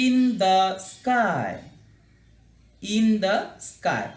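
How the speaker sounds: in the sky very slowly, falling; slow English drill. Shown as a demonstration. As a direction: model in the sky slowly for the class. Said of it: "কাছ থেকে তোমাদের দেখায়". (ধীরে ইংরেজি উচ্চারণ)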